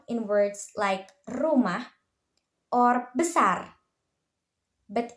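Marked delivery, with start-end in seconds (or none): 1.3-1.9 rumah with a trilled R; rolled R. Shown as a demonstration. Student teacher showing the Indonesian R in rumah, rolled R. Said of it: "when produced a rolled sound". 3.2-3.8 besar with a trilled R; rolled R. Shown as a demonstration. Student teacher showing the Indonesian R in besar, rolled R. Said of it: "when produced a rolled sound".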